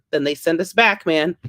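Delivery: ordinary talk, nothing marked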